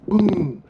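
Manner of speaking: loud, high mock boom; stomp sound effect. Diane stomping the ball flat, a loud high boom.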